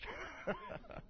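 high, airy burst of laughter; a quick, breathy laugh at the irony; breathless laugh. Real, not acted. Punchline laugh in sermon.

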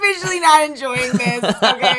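high, loud laughing exasperated queen; frazzled drag queen, laughing through it; Jinx impression. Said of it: "your Jinx is really good".

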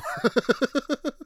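high rapid chuckle; laugh. Real, not acted. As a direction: crack up at your own line. Laughs at his own bluntness.

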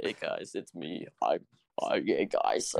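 very deep, slow voice; Corpse voice. Acted. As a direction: drop the voice way down low. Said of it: "I can, uh, fake my voice".

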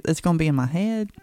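usual speaking voice

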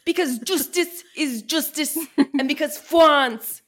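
hammed-up Clouseau French accent. Quoting the Pink Panther, hammed-up French accent. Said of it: "aggressively quoting the Pink Panther".

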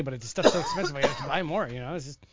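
normal talking voice

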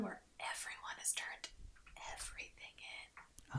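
soft unvoiced whisper. Real, not acted. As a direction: lean in and whisper. Whispered aside about class.

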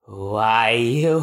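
ordinary talk

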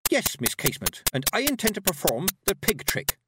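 put-on determined, projected young voice; loudly. A narrator's reading. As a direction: declare it for the whole crowd to hear. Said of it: "said Petulia loudly".

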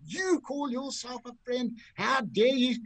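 high-pitched, drawn-out retort; mock indignant rebuke. President rounds on his golfing friend, mock indignant. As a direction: round on him in mock outrage. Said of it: "swung around at Blandy".

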